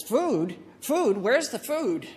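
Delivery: high, loud, incredulous whine; whiny complainer. Retold or imitated. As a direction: whine like a grumbling Israelite in the desert. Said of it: "the wilderness whiner says".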